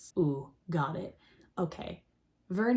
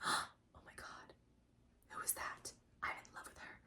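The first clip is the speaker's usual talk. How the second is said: breathless whisper; 20 dB quieter than usual. Acted. Hoshi whispering to himself, struck by love at first sight. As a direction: hush it like you can't believe your eyes.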